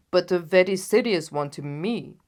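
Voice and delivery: loud, reproachful king; loud wounded royal reproach. Acted. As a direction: scold Holmes for taking it lightly. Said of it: "returned the king reproachfully".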